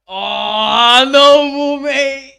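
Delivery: long, high, loud drawn-out cry; real loud gasp of disbelief; loud exclamation. Real, not acted. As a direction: belt it out in amazement. Reacting to dramatic moment.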